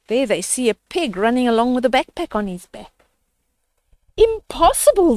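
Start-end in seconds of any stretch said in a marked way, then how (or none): 4.2-5.1 loud, high cry of disbelief; shocked sisters. Acted. Sisters seeing a pig with a backpack, crying out in disbelief. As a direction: blurt it out in shock. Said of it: "they said".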